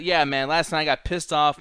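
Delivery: ordinary talk, nothing marked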